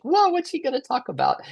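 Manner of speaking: squealing high, eager voice of an excited inner part. Retold or imitated. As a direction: gush like a kid who can't wait. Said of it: "so excited that Sarah's about to share".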